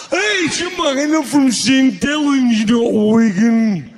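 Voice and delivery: loud, very slow, stretched Lancastrian impression; exaggerated dragging Lancastrian drawl; slowed-down Lancastrian accent. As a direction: talk Lancastrian like a record at the wrong speed. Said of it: "you're not playing at the right speed".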